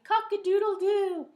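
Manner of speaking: high, loud cock-a-doodle-doo; rooster crow. A narrator's reading. Rooster crows.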